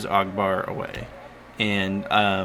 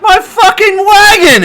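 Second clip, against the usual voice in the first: high, loud, slow outraged yell; furious scream; 17 dB louder than usual. Acted. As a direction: scream it like your livelihood just got wrecked. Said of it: "is screaming".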